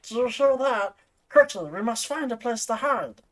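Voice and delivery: high-pitched gremlin character; urgent line in a gremlin's voice. Acted. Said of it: "I'll do the Gremlin voice".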